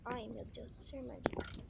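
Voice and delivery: tense whisper near the trap. Real, not acted. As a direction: whisper so the game won't hear you. Sneaking past the trap.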